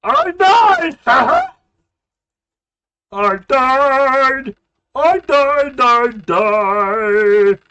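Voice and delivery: high, loud repeated cries; shouting. Real, not acted. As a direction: yell it out in disbelief. Shouting about dying in game.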